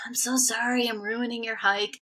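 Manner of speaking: retold weepy whisper; imitated tearful apology. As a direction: act out apologizing through tears in a whispery voice. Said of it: "I was bawling and crying".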